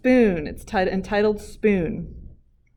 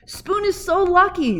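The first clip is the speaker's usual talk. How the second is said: envious Fork; 11 semitones higher, 4 dB louder than usual. Acted. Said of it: "said Fork".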